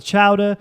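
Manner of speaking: "chowder" high and loud, both syllables clear; every syllable pronounced. Shown as a demonstration. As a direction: sound out "chowder" like a Californian. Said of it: "they pronounce".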